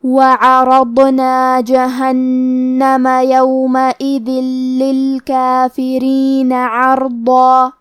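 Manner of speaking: very slow, melodic tajweed chant; Quran recitation. Acted. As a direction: recite the full passage slowly and melodically. Recites the verses in full. (قرآن کی آہستہ ترتیل)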